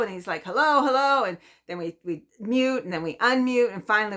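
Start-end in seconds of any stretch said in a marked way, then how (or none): 0.4-1.2 hello called out higher and louder, echoing back; calling hello down the phone. Retold or imitated. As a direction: shout hello back down the line.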